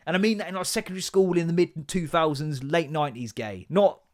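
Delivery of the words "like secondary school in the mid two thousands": ordinary talk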